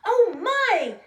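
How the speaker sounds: high, loud startled cry; frightened cry. Acted. Goldilocks waking to three bears, frightened cry. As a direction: gasp it out in fright. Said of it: "cried Goldilocks".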